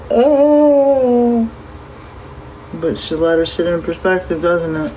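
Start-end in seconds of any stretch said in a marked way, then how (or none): 0.1-1.5 high, loud shocked gasp of a word. Retold or imitated. Reacting to seeing her brother up there, high and stunned. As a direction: let it drop out in shock.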